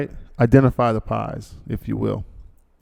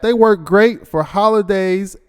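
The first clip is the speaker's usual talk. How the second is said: higher, louder and slower, leaning hard on "work"; hyped-up repeat; 8 semitones higher, 4 dB louder than usual. Shown as a demonstration. Host hyping the sponsor's holiday gifts to listeners, excited and slow. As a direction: sell it like the best news of the year. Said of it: "Look at that".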